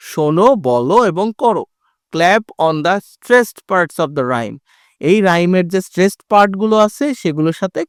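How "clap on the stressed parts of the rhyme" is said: slow, deliberate reading of the instruction; slow read-aloud. Shown as a demonstration. Teacher reading the task heading slowly to pupils. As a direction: read it word by word for learners.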